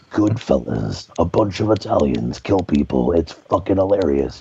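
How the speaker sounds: very low, booming-style movie trailer voice; deep, dramatic trailer voice-over; Don LaFontaine. Shown as a demonstration. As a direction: announce it like an epic trailer narrator. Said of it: "that kind of movie trailer voice".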